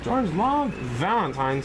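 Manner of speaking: ordinary delivery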